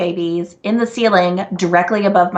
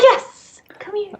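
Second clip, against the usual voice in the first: cooing to a kitten; 12 semitones higher, slower than usual. Pretend. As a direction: coax the little kitten in, all sweetness. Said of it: "And I'll be like".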